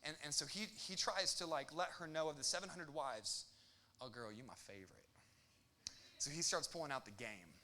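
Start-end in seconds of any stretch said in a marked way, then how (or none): 4.0-5.0 low, soft smooth-talking line; smooth flirt. Retold or imitated. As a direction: croon it like a player. Solomon sweet-talking favorite wife.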